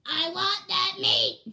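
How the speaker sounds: loud, slow, forceful character voice; Raja. Pretend. Imitating a pet demanding meat, loud serious voice. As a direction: boom out each word, heavy and separate. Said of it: "deep and serious voice".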